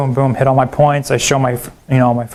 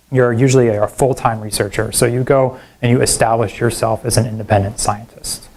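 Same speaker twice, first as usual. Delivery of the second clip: ordinary talk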